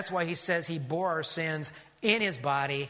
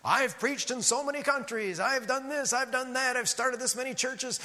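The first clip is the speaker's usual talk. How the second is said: boastful resume; 8 semitones higher than usual. Retold or imitated. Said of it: "Like a resume, right?".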